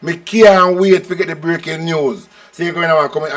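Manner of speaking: ordinary talk, nothing marked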